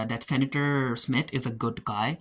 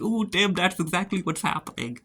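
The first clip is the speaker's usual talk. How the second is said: high, teasing "ooh" at the screen; knowing tease; 6 semitones higher than usual. Real, not acted. Viewer teasing a sad character on screen, high and knowing. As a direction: rib Tim like you saw it coming. Reacting to Tim's song lyrics.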